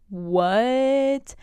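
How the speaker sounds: a loud, high, disbelieving "what"; outraged disbelief at the screen; shocked. Real, not acted. Outraged at the plot twist.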